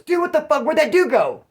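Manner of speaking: loud, high-pitched, incredulous outburst; imitating a man's alarmed shouting; agitated retold yell. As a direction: yell it like someone demanding answers.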